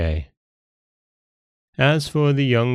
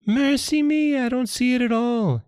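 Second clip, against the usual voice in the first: very high-pitched mock alarm; feigned dismay monkey. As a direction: pretend shock as a squeaky monkey. Monkey feigning surprise at missing liver.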